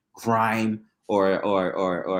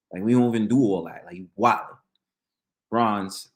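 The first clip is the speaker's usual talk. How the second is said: ordinary delivery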